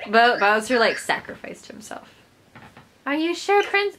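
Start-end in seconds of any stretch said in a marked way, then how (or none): 3.1-4.0 high, put-on princess voice; her high voice. Character questioning the princess, mistakenly in her high voice. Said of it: "Oh, that's her voice".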